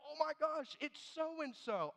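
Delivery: high, delighted recognition; light up at a familiar face; mock-thrilled spotting a church member; excited greeting. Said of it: "And I'll be like".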